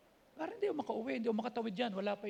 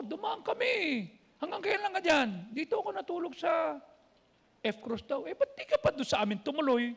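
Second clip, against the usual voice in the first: high, loud banter; lively phone banter; 6 semitones higher, 6 dB louder than usual. Retold or imitated. Pastor and friend banter on the phone, high and loud. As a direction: trade quick eager lines. Acted phone call, both sides.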